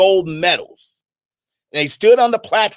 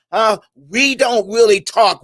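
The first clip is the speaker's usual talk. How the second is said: ordinary talk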